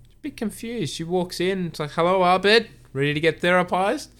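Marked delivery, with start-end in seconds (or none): 1.9-4.1 higher, louder, cheery hello; chirpy greeting. Retold or imitated. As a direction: breeze in all bright and eager. Britta greets Abed brightly.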